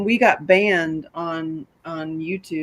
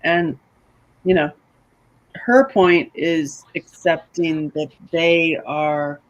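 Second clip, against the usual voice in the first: normal talking voice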